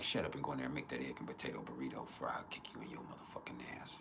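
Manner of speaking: very low, hushed grumbled threat; muttered mock threat. Boyfriend ordering girlfriend to make a burrito, muttered mock threat. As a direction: mutter it way down under your voice.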